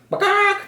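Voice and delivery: very high, loud hen squawk; hen cluck. A narrator's reading. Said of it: "said the hens".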